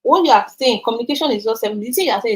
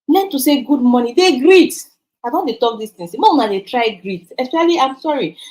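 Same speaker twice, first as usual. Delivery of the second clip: ordinary delivery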